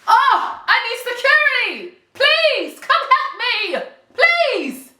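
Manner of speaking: mock damsel plea, pitched very high; Giselle. Host acting out a housewife calling for security, hammed-up high plea. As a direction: wail for help in hammed-up distress. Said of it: "Kenya should have done the Giselle".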